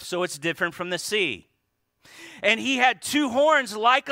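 loud, high, driving preacher's voice; preaching at full force. Acted. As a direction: preach it out to the congregation.

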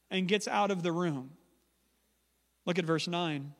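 ordinary talk

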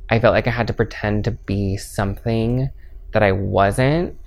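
usual speaking voice

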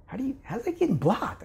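can't believe what you're watching; incredulous. Retold or imitated. Amazed at beach volleyball blocking.

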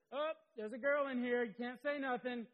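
high, loud mock outburst; imitated tactless guy. Man announcing a girl in the room, mock alarmed yell. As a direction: blurt it out as a caricature of an alarmed man. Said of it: "Just like that".

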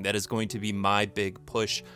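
ordinary delivery

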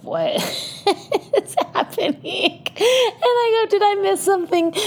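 very high, slow, laughing incredulity; stunned, cracking up at the news; laughing disbelief. Real, not acted. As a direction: squeal it out through laughter. Shocked at boyfriend's news.

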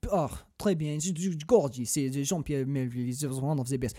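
put-on soft, murmuring French accent; put-on French accent, savoring the words. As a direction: rhapsodize quietly like a French cinephile. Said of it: "I base my French accent off of".

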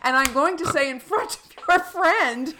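high, loud hammed-up announcement; mock loud confrontation. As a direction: barge in with an exaggerated accusation. Mocking Jerry barging in.

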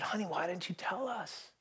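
very soft, gentle question; hushed parental concern; tender father. Retold or imitated. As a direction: ask softly, as to a child just woken. Father comforting daughter after bad dreams.

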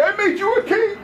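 high, laughing tease; a buddy mock-tempting his fasting friend with cake, high and laughing; teasing tempter. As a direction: dangle the cake in front of him, laughing.

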